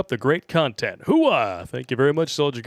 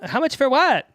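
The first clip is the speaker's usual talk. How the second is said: high stripper voice; 13 semitones higher, 4 dB louder than usual. Acted.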